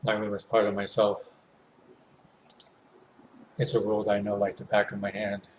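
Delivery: usual speaking voice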